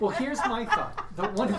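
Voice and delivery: high, loud, laughing as you talk; cracking up mid-sentence. Real, not acted. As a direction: try to get it out through real laughter. Cracking up at own idea.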